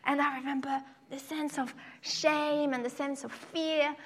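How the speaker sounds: ordinary talk, nothing marked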